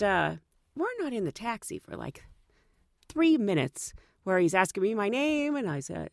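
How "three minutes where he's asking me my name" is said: ordinary talk, nothing marked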